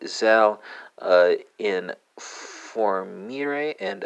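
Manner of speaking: "zal informere" said slowly in Dutch; slow Dutch demo. Shown as a demonstration. Teacher slowly modelling "zal informere" for Dutch learners. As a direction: stretch out "zal informere" word by word. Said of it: "let's go from French to Dutch".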